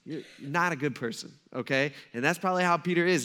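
ordinary talk, nothing marked

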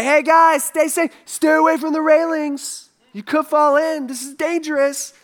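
a hint of a nervous chaperone; mock fussy warning. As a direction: play an overcautious parent, lightly. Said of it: "He doesn't say".

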